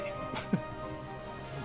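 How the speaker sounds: soft, breath-only laugh; quiet chuckle. Real, not acted. Gamer amused by own wordplay, quiet chuckle.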